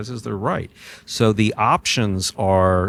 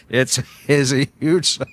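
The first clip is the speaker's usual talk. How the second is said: laughing nervously through the words; giggly, nervous and higher; 6 semitones higher than usual. Real, not acted. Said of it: "And that's my nervous laugh".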